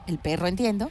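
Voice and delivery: ordinary delivery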